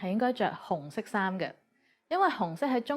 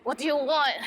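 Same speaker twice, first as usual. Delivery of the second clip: higher, playfully irritated challenge; mock angry; 5 semitones higher, 3 dB louder than usual. Shown as a demonstration. Teacher translating an angry greeting for learners, mock angry. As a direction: demand it in mock annoyance. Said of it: "if you say it angrily".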